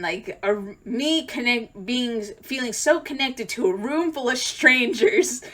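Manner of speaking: usual speaking voice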